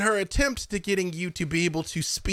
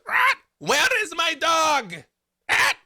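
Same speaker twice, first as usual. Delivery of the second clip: much higher, louder slow call; shouted call; 14 semitones higher, 4 dB louder, slower than usual. Acted. Havilar's scrambled voice shouting for her dog. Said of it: "he goes".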